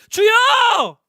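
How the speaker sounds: very loud, high, desperate call; shouted cry. Retold or imitated. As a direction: scream out to the Lord. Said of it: "근데 어떻게 소리 질렀냐고". (주님을 향해 목청껏 부르짖기)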